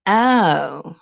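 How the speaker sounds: put-on hands-on-hips girlfriend wanting a word now; the girlfriend's fake-sweet challenge. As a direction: play the girlfriend pulling him aside, syrupy and fast. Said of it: "your girl be looking at you like".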